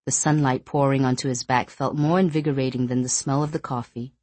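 usual speaking voice

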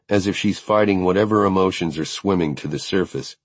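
plain reading voice

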